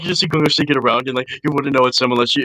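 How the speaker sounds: usual speaking voice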